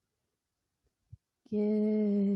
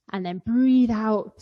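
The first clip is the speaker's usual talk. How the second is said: louder, drawn-out breathe out; loud slow cue; 9 dB louder than usual. Shown as a demonstration. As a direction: call it out slow and big. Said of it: "you ready to do it with me?".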